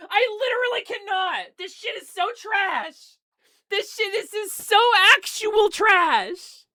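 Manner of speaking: high, loud real ranting; mad, exasperated. Real, not acted. Streamer who just threw the controller, mad and exasperated. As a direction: wail it in fed-up fury, drawing out the insults. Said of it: "I'm so mad".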